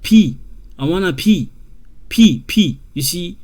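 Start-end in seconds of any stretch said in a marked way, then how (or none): 0.0-0.4 P said loud and high like the letter name. Shown as a demonstration. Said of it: "like you say P". 2.1-2.8 P said loud and high like the letter name. Shown as a demonstration. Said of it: "like you say P".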